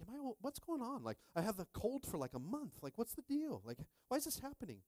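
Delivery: high, baffled whining; mock bewildered self. Retelling his own confusion about the cold, high and bewildered. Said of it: "and I'm like".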